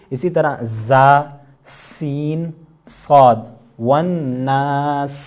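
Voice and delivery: زا سین صاد hissed slowly, والناس with a long س; whistling letters demo. Shown as a demonstration. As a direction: hiss each letter slowly. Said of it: "آخر میں سین کی آواز نکل رہی ہے". (سیٹی کی آواز کے ساتھ حروف)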